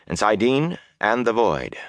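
usual speaking voice

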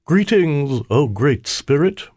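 high, slightly squeaky imitation of a king; cool, put-on royal greeting. A narrator's reading. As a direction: greet grandly, faking a king's voice. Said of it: "I spoke coolly".

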